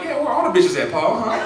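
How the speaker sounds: put-on high, teasing girlfriend voice; mock girlfriend. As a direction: needle him like his girl.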